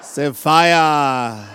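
"fire" ending in an open a, no r; West African accent. Shown as a demonstration. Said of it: "Try to say it like an".

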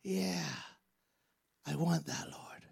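very soft, halting murmur; wince, then quietly give in; pained acceptance. Acted. Believer reluctantly accepting God's hard word, soft and pained. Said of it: "We usually go".

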